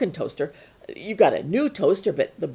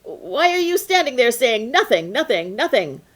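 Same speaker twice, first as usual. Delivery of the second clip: louder, higher irritated fisherman; annoyed fisherman; 6 semitones higher, 6 dB louder than usual. Acted. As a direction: snap at the boy, exasperated. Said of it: "the fisherman got very annoyed".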